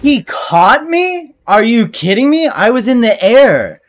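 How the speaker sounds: high outraged yelling; raging gamer. Real, not acted. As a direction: yell in outraged disbelief. Gamer killed unfairly.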